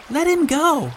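put-on high girlish voice; high-pitched girl's plea; young girl Violet. A narrator's reading. Violet interceding for Benny.

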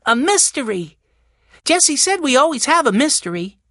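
high, hammed-up boyish teasing; trying to be funny. A narrator's reading. Benny teases Jessie about mysteries, trying to be funny. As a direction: tease in a high, playful child's voice. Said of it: "trying to be funny".

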